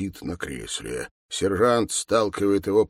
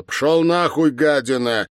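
loud, raised outburst; angry cursing; 6 semitones higher, 5 dB louder than usual. Acted. Mikhail swearing at the sergeant kicking him, angry. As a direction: curse him off, starting high.

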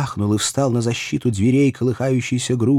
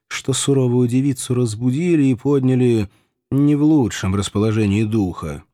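ordinary delivery, nothing marked

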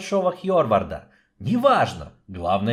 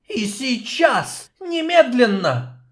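high, slow greedy hiss; hissing snake voice; 12 semitones higher, 4 dB louder, slower than usual. Acted. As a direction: gloat like a greedy serpent. Snake king insists.

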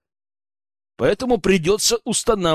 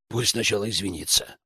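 low, soft grumble; sulky; 6 semitones lower, 6 dB quieter than usual. A narrator's reading. Wronged lad demands an apology from his mate, sulky. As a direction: sullen and sulking, voice dropped. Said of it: "насупился Иван".

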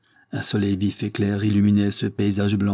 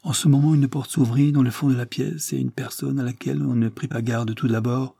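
usual speaking voice

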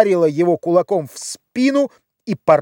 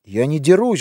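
ordinary delivery, nothing marked

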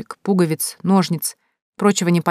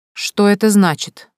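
slow, louder, haughty demand; 4 semitones higher, 5 dB louder, slower than usual. A narrator's reading. Mother confronting her son's hostess, haughty. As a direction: demand an explanation, drawing it out. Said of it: "Мать надменно выпятила подбородок".

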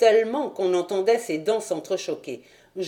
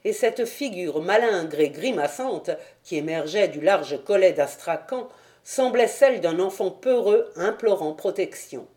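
ordinary delivery

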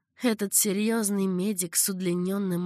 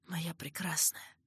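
tender whisper; 2 semitones lower, 10 dB quieter than usual. A narrator's reading. Caliph's farewell to beloved.

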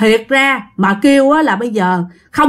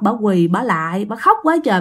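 ordinary talk, nothing marked

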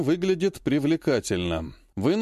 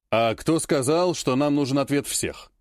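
usual speaking voice

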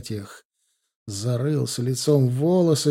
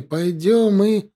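rising insistent call; 7 semitones higher, slower than usual. Acted. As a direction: demand he follow. (громче и настойчивее)

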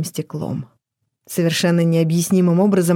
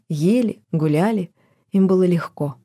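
plain reading voice

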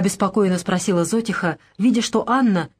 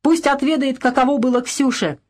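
high angry cries; the women shout to drag him to the ice hole; mob shouting; 5 semitones higher than usual. A narrator's reading. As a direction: egg each other on, rising fury. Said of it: "кричали со всех сторон".

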